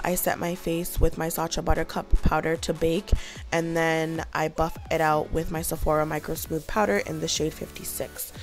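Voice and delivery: ordinary talk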